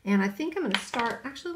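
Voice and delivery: ordinary talk, nothing marked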